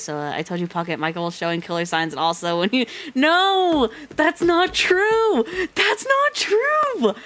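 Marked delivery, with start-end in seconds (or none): 3.1-7.1 high, slow, loud shriek; screaming denial. Real, not acted. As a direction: refuse the twist at full voice. Denying plot twist.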